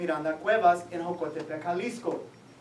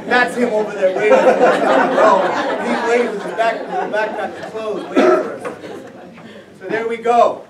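louder, words broken by real laughter; laughing while talking; 10 dB louder than usual. Real, not acted. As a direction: talk through the giggles. Laughing while describing the uncle.

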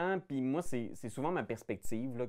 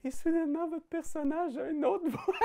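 much higher, floaty light voice; light-headed voice. Shown as a demonstration. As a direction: speak as if your head were a balloon. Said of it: "Imaginez que votre tête est légère".

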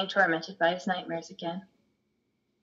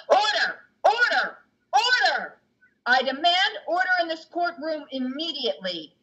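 stern judge bellowing much higher and louder; judge shouting order; 9 semitones higher, 4 dB louder than usual. Acted. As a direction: bang the gavel and roar for order. Judge pounds his gavel.

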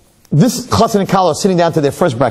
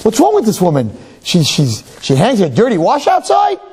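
put-on higher, scandalised young wife; young wife's mock disbelief; 8 semitones higher than usual. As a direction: gossip about the neighbour in disbelief. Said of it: "she says".

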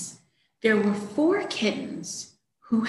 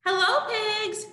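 duck voice; 11 semitones higher than usual. Acted. Duck greets pigs, high voice.

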